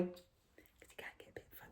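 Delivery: breathy whispered aside. Real, not acted. Muttering to the viewers about the cat, in a whisper. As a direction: drop to a whisper to share a secret.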